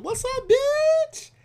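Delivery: high, slow playful greeting; mock greeting. Imitating friends greeting each other, high and slow. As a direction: toss it off like a friendly hello. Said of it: "a salutation".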